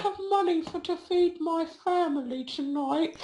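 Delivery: put-on small boy begging for money for his family; little boy voice. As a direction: plead in a tiny kid voice. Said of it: "And he was like".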